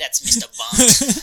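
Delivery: squeaky Mister Bunt. Retold or imitated. Co-host confirms the name, squeaky high voice. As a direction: pinch the voice up on Bunt.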